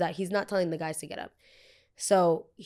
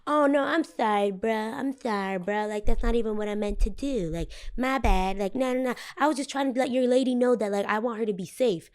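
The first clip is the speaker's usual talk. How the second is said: fake-nice apology; 4 semitones higher than usual. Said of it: "he was like".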